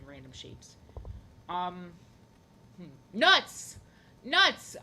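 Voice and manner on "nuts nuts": shouted exclamation. Real, not acted. Reacting to a viewer's chat message, loud exclamation. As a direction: blurt out Nuts loud and high.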